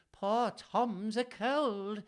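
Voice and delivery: very slow, high Fool's voice; Shakespearean Fool. Shown as a demonstration. Reader demos the Fool for listeners, slow high put-on voice. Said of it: "he's gonna be".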